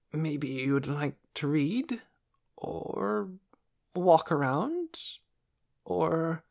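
slow, halting, lightly voiced doctor; flustered. A narrator's reading. Doctor fumbling to entertain her guests, flustered. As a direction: grasp for ideas, trailing off. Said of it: "looking flustered".

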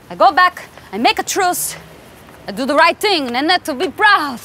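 lightly put-on Italian accent; broken English with an Italian lilt. Italian pasta boss scouting a model, Italian accent.

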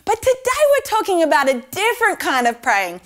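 ordinary talk

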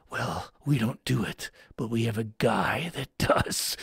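a hint of a low bored clerk; lightly voiced low exterminator. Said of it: "they go".

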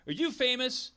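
high, lightly voiced fan; a stranger asking Jack, pitched high; curious stranger. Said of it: "strangers coming up to Jack and going".

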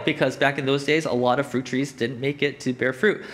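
ordinary talk

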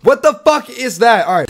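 ordinary delivery

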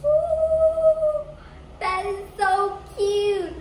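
very high, loud delighted coo; excited squeal. Real, not acted. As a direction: squeal over something adorable. Sees cute dog gummy.

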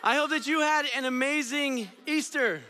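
high, loud stage greeting; hype welcome. Acted. Youth pastor welcoming the kids, loud hype. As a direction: pump up the room.

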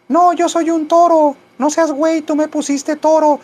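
very high, indignant cat falsetto; talking cat. Pretend. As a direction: argue back as a squeaky little cat. Cat argues back in falsetto. (gato indignado en falsete agudo)

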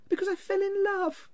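very high, light voice; earnest young nephew. A narrator's reading. Nephew explaining his marriage to his uncle, high earnestness. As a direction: answer in a high, innocent lilt.

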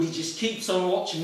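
usual speaking voice

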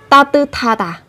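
"ta tư tha tà" with the t carried over as breathy th; linked aspirated th. Shown as a demonstration. Teacher shows how to pronounce it, linked "ta tư tha tà". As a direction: run "tư tha" together, puff of air on "tha". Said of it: "Sẽ phát âm là".

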